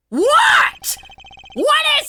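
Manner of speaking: very high, loud shout that cracks; voice cracking. Acted. Character snapping at an interruption, voice cracking shout. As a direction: yell it till the voice breaks. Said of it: "my voice cracked on that".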